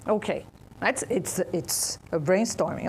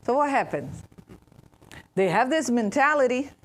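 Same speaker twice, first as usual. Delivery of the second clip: ordinary delivery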